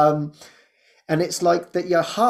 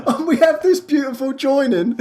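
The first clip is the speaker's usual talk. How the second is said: much higher, gushing and laughing; delighted; 13 semitones higher, 4 dB louder than usual. Real, not acted. Praising Jessie for loving the spreadsheet, giddy and laughing. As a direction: gush with delight about her friend.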